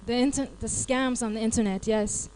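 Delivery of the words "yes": ordinary talk, nothing marked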